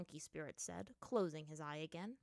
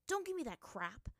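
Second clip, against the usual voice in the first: louder, higher, harsh retort; 5 semitones higher, 5 dB louder than usual. A narrator's reading. Aang rebukes the dismissive monkey, harshly. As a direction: snap back with irritation. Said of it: "Aang said to him harshly".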